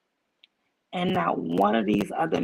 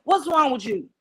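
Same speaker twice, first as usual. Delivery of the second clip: alarmed dad; 7 semitones higher, 4 dB louder than usual. Retold or imitated. Dad questioning crying child, retold.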